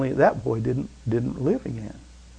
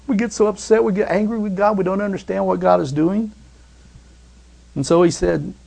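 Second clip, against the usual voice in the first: ordinary talk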